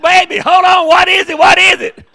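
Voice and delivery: high, loud calling over the noise. Retold or imitated. Husband on the phone straining to hear his wife, shouting. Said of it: "I said".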